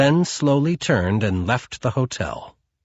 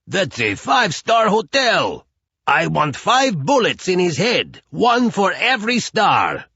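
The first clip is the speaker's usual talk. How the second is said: apoplectic shout; 8 semitones higher than usual. Acted. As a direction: roar it in rage. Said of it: "Chukov was apoplectic".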